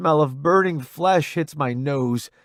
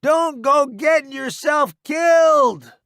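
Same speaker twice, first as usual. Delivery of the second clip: high, loud call to someone leaving; calling after; 14 semitones higher, 7 dB louder than usual. Acted. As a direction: shout it after him. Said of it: "he says after me".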